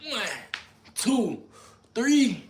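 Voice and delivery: straining count while doing push-ups. Real, not acted. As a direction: gasp each number out mid push-up.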